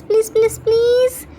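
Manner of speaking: begging. Acted. Cook begging Yungi to teach him, rising pleading. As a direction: whine it out, rising each time. Begging brother for cooking help.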